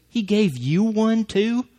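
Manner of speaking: high, drawn-out aggravated disbelief. Retold or imitated. Area manager reacts to the speaker's jacket, aggravated. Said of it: "he was aggravated".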